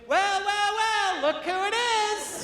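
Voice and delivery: high, sing-song mock of his wife, slowing on must be nice. Comedian mimics his wife needling him in prison: high mocking sneer. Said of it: "she'd be like".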